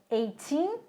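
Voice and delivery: put-on "eighteen" with long, rising "teen"; "eighteen", stressed at the end; stress on the second syllable. Shown as a demonstration. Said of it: "a sílaba tônica tá no teen".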